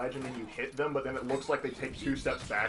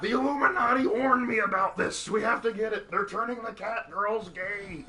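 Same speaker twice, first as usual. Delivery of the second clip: loud, high-pitched mock conspiracy rant; hammed-up alarmist in a muddled put-on accent; botched Alex Jones; 8 semitones higher, 6 dB louder than usual. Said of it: "I don't know what accent I was going for".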